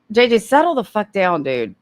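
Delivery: normal talking voice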